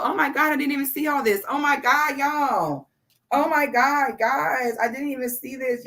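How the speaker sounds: genuinely floored by the gifts; overwhelmed excitement. Real, not acted. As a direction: spill it out in disbelief. Overwhelmed discovering many gifts.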